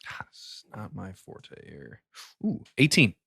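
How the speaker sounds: ordinary talk, nothing marked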